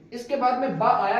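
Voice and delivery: normal talking voice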